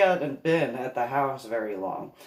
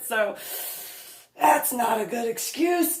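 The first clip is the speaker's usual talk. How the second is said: usual speaking voice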